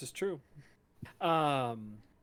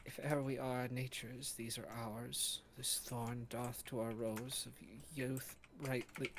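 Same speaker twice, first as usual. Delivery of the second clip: muttering; 8 dB quieter than usual. A narrator's reading. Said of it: "you can hear her muttering".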